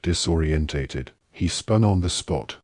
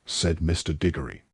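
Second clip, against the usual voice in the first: plain reading voice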